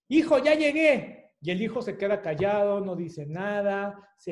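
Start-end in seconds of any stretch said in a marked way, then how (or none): 0.1-1.3 calling out. Acted. Mom arriving home calls out.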